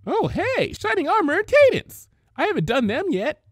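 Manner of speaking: very high, bright squeaky voice; bubbly Pinkie. Acted. Pinkie Pie voicing her toys, very high and bubbly. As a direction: bubble over.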